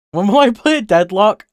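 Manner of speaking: high, loud mocking voice of a chatter; mock questioner. Streamer mimicking a viewer's question, high and mocking.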